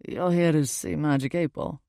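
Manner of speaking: put-on British-accented stranger asking casually. A narrator's reading. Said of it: "He has this British accent".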